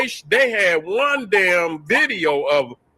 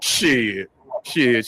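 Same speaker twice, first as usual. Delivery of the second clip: ordinary delivery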